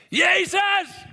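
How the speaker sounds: full-force shout, pitched very high; screamed cry. Acted. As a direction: yell it out at the top of your voice. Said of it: "begint het uit te schreeuwen".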